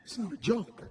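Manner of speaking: ordinary delivery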